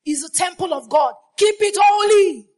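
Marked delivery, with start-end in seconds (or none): 1.4-2.5 loud, high command; fiery exhortation; preaching shout. Acted. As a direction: shout it from the pulpit.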